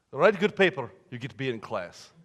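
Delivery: mock Russian professor, matter-of-fact; bad Russian accent. Professor repeating his deal to student, bad Russian accent. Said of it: "don't like bad Russian accents".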